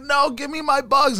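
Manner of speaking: high-pitched imitation of an upset child; mock tearful protest. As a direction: plead like a kid freaking out. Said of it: "he started crying when the teacher started".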